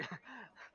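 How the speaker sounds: sudden high giggle; high laugh. Real, not acted. Player laughs at forgetting name.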